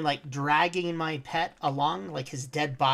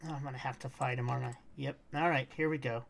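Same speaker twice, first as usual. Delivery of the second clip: usual speaking voice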